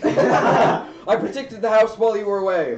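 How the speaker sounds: high, loud excited knight; devoted servant shouting with joy; eager loyal knight. Acted. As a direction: report proudly to your master at full volume. Knight reports proudly to master.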